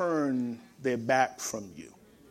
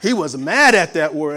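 much louder, punching mad; emphatic repeat; 9 dB louder than usual. Shown as a demonstration. Preacher stressing brother's anger to congregation, loudly. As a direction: hit the repeat hard and loud. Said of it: "he was hostile with that".

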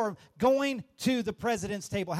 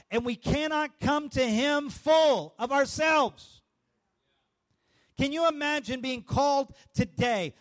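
raised, rising preacher's cadence, building to a shout; full-force preaching; 5 semitones higher than usual. Acted. Pastor preaching to the congregation, rising to a shout. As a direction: preach it out to the whole room.

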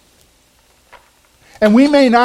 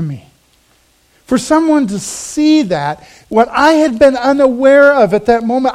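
higher, louder impassioned preaching; preaching with fervour; 6 semitones higher, 4 dB louder than usual. Acted. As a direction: lift the congregation with it. Preacher rising to emphasis.

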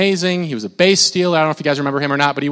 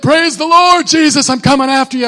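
higher, louder strained shout; hoarse camp shout; 9 semitones higher, 6 dB louder than usual. Retold or imitated. Teen at youth camp praising loudly, hoarse. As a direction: cry out with a voice yelled raw. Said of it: "You're like".

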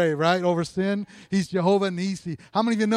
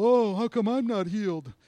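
whiny complaint; 4 semitones higher than usual. Retold or imitated. Jealous believer complaining.

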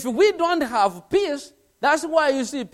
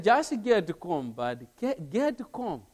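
calm soothing; 6 semitones lower, 6 dB quieter than usual. Retold or imitated. Calming an angry coworker.

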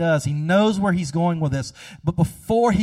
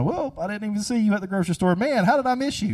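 fake surprise; 5 semitones higher, faster than usual. Faking surprise to avoid someone.

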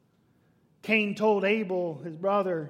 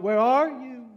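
calling out; 5 semitones higher, 7 dB louder than usual. Acted. God cries out.

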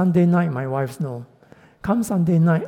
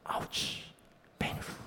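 breathy whisper, as if wincing; hushed pained aside; whispered ouch; 18 dB quieter, slower than usual. Acted. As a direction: whisper it like a sharp sting. Preacher whispers the pain of surrender.

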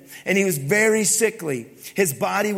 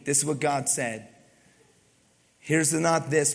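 ordinary talk

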